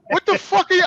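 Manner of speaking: high-pitched, indignant outburst; acted outrage at cousin; incredulous retort. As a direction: blurt it out in retold disbelief. Disbelieving retort to cousin, retold.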